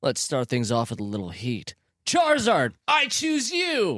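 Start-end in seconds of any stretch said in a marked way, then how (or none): 2.0-4.0 very high, slow called-out command; battle cry. Acted. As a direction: yell it like an anime hero. Ash calls out Pokemon.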